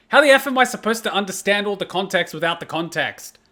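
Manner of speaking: usual speaking voice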